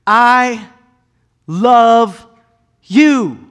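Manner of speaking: each word set apart, high and loud; drawn-out, emphatic divine declaration; God speaking. Acted. God to humanity, slow emphatic words. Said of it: "God made an emphatic stamp".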